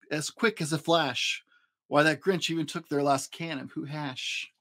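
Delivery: plain reading voice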